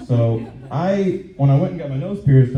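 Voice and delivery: ordinary talk, nothing marked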